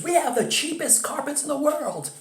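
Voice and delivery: very high, comical commercial pitchman; Phil the carpet store guy. Retold or imitated. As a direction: hawk it in a squeaky-high mock ad voice. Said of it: "like the Phil Carpet store guy".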